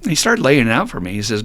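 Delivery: ordinary talk, nothing marked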